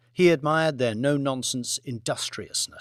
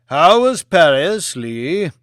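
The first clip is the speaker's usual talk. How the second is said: Lord Martin's voice; 3 semitones higher, 10 dB louder, slower than usual. Acted. Lord Martin asks Lee about Paris, loud and slow. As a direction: ask it with polite curiosity.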